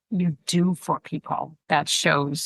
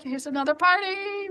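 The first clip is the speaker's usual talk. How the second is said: very high, excited party call; exuberant family cheer; 12 semitones higher than usual. Retold or imitated. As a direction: imitate relatives thrilled at another party. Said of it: "the Italian exuberant".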